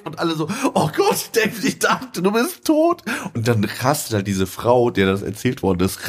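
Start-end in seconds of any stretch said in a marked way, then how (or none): 0.8-3.0 high, rising cry of disbelief; shocked relief. Retold or imitated. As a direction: shriek it as if seeing a ghost. Housemates shocked to see David.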